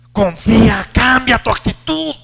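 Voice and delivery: loud, high "confía, cambia tu actitud"; rising shout. Acted. Preacher shouting God's command to the congregation. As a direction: thunder the command.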